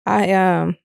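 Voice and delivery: ordinary delivery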